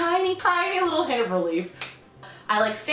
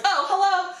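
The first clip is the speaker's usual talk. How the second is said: high, sugary fangirl hello; pretend sweet greeting; 8 semitones higher than usual. Imagining greeting Got7 while soaked, high sweet chirp. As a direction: put on a chirpy polite meet-and-greet voice. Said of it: "it's gonna be like a flip".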